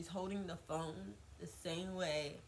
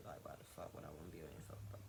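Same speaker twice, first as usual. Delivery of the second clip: fast, much softer irritated aside under the breath; muttered aside; 14 semitones lower, 11 dB quieter, much faster than usual. Real, not acted. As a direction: mutter it quickly to yourself, annoyed. Annoyed aside about the ex's family.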